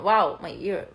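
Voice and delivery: ordinary delivery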